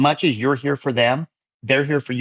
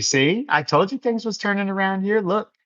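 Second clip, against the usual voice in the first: pitched way up, told-you-so glee; excited gloat; 7 semitones higher than usual. Real, not acted. Streamer gloating to chat that he was right, pitched high and gleeful. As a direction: crow that you called it.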